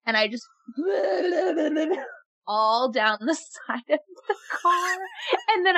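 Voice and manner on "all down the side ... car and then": laughing while telling it; cracking up mid-sentence. Real, not acted.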